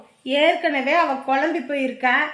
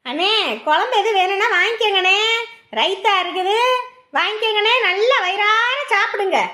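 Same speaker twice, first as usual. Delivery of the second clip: stall seller calling; 8 semitones higher than usual. Acted. Food stall seller calling customers.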